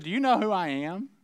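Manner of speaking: lightly acted challenge, rising at the end; mock challenge with a lifted last word; pointed question. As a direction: ask it slyly as the student. Student challenges professor in story.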